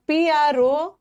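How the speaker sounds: slow letter-by-letter spelling of p r o n o u n; teacher spelling a word aloud, slowly. Shown as a demonstration. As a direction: spell each letter slowly and clearly for students. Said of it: "spelling देख लेना".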